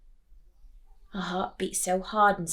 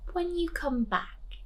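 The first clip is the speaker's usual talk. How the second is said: high, slow, knowing hint; higher sly other father; 10 semitones higher than usual. Acted. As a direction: drop a sly hint slowly.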